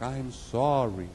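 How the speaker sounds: slow, unraised echo reply; distant echo. Acted. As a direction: let it float back slowly. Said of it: "The voice came back".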